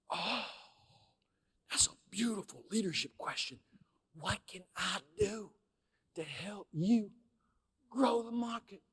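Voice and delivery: hushed awe. Retold or imitated. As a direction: breathe the question out in quiet admiration. Awed by the boss's question.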